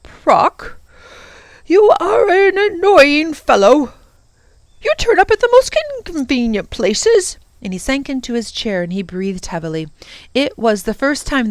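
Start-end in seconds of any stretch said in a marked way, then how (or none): 0.0-7.4 put-on high, loud exasperated old man voice; irritated professor. Professor scolding the Prock, loud and exasperated. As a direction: snap at the intruder, fed up.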